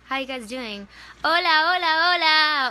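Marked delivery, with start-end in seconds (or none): 1.2-2.7 excited greeting. Real, not acted.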